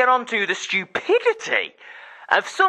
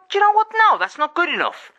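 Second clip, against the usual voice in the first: indignant retort; 9 semitones higher, 3 dB louder than usual. Retold or imitated. Angry retort to the excusers.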